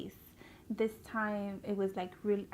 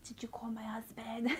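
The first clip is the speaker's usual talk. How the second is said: imitating barely being able to speak; weak, whispery mock post-surgery voice; dry-throated croak; 3 dB quieter than usual. Patient asking the nurse after surgery, straining through a dry throat. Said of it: "I couldn't really speak because my throat was dry".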